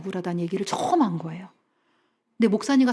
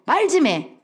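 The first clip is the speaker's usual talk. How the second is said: demanding nag; 7 semitones higher, 4 dB louder, slower than usual. Retold or imitated. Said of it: "그 남편들 할 말이 없어요".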